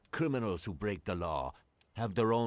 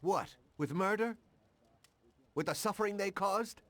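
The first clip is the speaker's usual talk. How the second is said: outraged; 9 semitones higher than usual. Acted. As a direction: pitch jumps much higher in shocked outrage. Cop outraged in argument.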